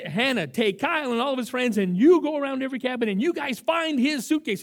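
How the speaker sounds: loud, firm orders shouted across camp; rally the kids like a camp boss; commanding orders. Retold or imitated. Director ordering Hannah and the boys to search, loudly.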